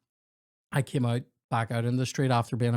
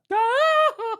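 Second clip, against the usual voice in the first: high, loud yelped scream; Wilhelm scream imitation; 8 dB louder than usual. Shown as a demonstration. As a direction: yelp like a movie stuntman falling. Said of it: "like that".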